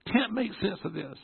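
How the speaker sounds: high-pitched anguished plea; inner desperation. Retold or imitated. Son crying out inside to God, high desperate plea. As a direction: plead in a high voice. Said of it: "inside I'm going".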